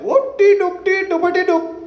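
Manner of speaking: high, slow mock Indian accent gibberish; imitating an Indian accent from afar; Apu impression. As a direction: sing it like a cartoon Indian accent. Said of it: "go like Apu from The Simpsons".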